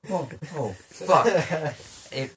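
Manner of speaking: ordinary delivery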